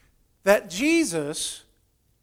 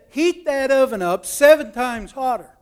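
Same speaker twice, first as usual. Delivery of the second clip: mildly angry command, higher and louder; retold furious king; 5 semitones higher, 5 dB louder than usual. Storyteller as enraged king ordering the furnace heated, mock anger. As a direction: hammer out the order as a cross king. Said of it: "the king got furious mad".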